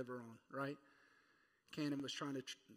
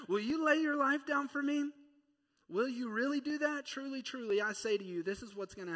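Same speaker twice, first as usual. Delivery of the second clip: higher, probing questions; questioning voice of Jesus, raised pitch; searching Jesus; 8 semitones higher, 6 dB louder than usual. Acted. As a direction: press him gently but firmly.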